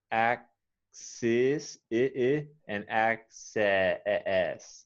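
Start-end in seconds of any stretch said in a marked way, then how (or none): 2.7-4.8 "access" slowly drawn out with a long e sound; slow contrasting access. Shown as a demonstration. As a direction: stretch the e in "access". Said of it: "the difference is the, the second vowel sound".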